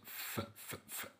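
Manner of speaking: ف- as pure breath, no voice; voiceless f demo; voiceless f sound. Shown as a demonstration. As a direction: blow air for ف- without voice. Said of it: "انفخ بس من غير ما تحرك أحبالك الصوتية".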